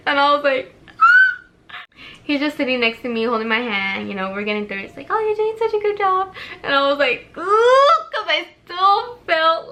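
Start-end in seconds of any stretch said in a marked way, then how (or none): 1.0-1.8 short, very high, loud alarmed shriek. Retold or imitated. Seeing husband look at stomach. 5.1-6.3 high, sweet encouraging voice; encouraging husband. Retold or imitated. 7.4-7.9 high, loud pained ooh. Retold or imitated. Pain during surgery.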